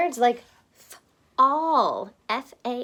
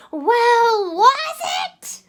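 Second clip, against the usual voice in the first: high, loud call; eager high holler; 11 semitones higher, 10 dB louder, much slower than usual. Acted. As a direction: shout after the bird. Rocket calling out to bird.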